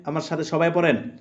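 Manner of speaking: ordinary talk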